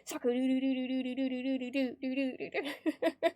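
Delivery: long rapid du-du-du run off Sakura; machine-gun repeated syllables, playfully; playful remix stutter. Pretend. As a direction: stretch the stutter into a rhythmic remix, breaking up at the end. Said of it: "Sakura remix".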